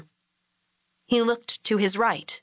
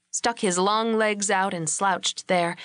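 ordinary delivery

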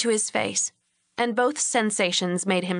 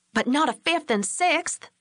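higher, biting sarcastic comeback; sarcastic retort; 7 semitones higher than usual. A narrator's reading. Lori snapping back at husband about Billy, sarcastic. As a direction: throw it back at him with scorn. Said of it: "she retorted sarcastically".

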